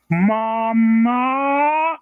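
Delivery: high, drawn-out cat yowl that sounds like mama; cat saying mama. Retold or imitated. Owner imitates the cat greeting his returning wife, long yowled mama. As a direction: stretch it into a long feline cry. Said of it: "He went".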